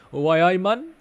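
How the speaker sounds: loud, high-pitched mock Geordie; terrible Geordie accent. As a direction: put on a bad Geordie accent, calling out. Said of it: "That's my terrible Geordie accent".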